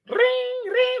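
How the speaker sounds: high sung ring ring; ringing phone imitation. Telling friends about being stuck, mimics phone ringing.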